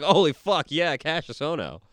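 loud, high mock crowd reaction; imitated excited fan. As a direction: burst out like a thrilled fan. Imagined crowd reaction to a call-up.

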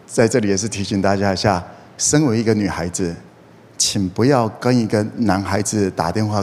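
ordinary talk, nothing marked

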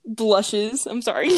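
ordinary talk

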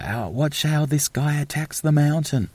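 high-pitched imitation of an excited commentator; Striker carrying on. Imitating the commentator raving about the skater's run, excited and high. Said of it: "he's just carrying on about".

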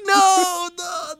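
high, squeaky no no; mock wail. Hearing they still talk about him, high mock wail. Retold embarrassment.

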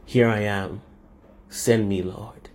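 low, measured hero entrance; solemn volunteer. Acted. The Son offers himself to God, solemn volunteering. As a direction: answer gravely, fading soft on the last word. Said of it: "and he just says".

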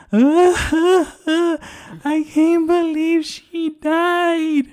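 high, slow fake sobbing voice; mock bawling. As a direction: ham up a crying drunk passenger. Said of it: "he starts crying".

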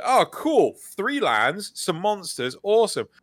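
high, bright mock enthusiasm over a bad hand; sarcastically cheering a terrible hand, pitched well up; sarcastic fake cheer. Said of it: "Like, like".

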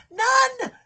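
high tearful shout; crying out. Real, not acted. Preacher crying out that God wants no one in hell, tearful shout. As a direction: cry it out with breaking emotion.

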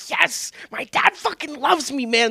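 slow, high, stretched-out boast; milk it like a thrilled kid; hammed-up joy. Retelling his younger self's joy, slow and high. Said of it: "I was like".

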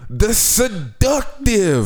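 slow, high showman title; grand self-announcement. Pretend. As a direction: announce yourself like a star. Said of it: "Say it with me".

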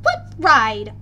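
loud, demanding question. A narrator's reading. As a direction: demand an answer sharply. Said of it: "Bianca demanded".

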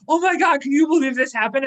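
high, loud imitated outrage; mock dismay. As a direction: imitate a worked-up parent friend. Imitating worried friends bringing problems.

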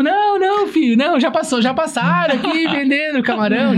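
high-pitched imitation of a refusing old man; exasperated refusal, retold. Old man turning down the boy's second shrimp request, mock exasperated refusal.

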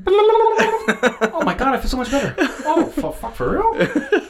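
high, slow, loud hammed-up delight; high mock woman overjoyed; mock ecstatic relief. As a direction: squeal out a hammed-up relieved patient. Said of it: "he's all like".

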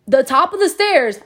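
usual speaking voice

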